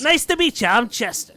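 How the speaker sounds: loud, high-pitched gnome voice; high, booming stage greeting; Jester's showy introduction. Acted. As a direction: introduce yourself like a loud little showman. Game master voices Jester introducing himself.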